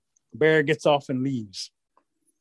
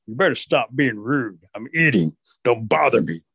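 lower, weighty bear voice; grumpy threatening bear; 3 semitones lower than usual. Acted. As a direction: warn the pest off, slow at first.

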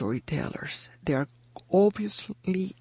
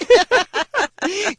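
high burst of real laughter; 7 dB louder than usual. Real, not acted. Guest laughing at her own boxer impression, bursting laugh. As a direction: breaks into a giggle at herself.

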